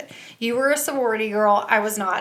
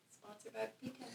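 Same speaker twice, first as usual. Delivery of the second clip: very soft, barely audible aside; feed the line under your breath; quiet off-mic cue; hushed prompt; 27 dB quieter, faster than usual. Real, not acted.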